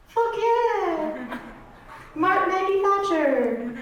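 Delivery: high-pitched mock British moaning; drawn-out, squeaky fake British bedroom cries; bad British accent. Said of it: "get a better accent".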